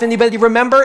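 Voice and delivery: ordinary delivery, nothing marked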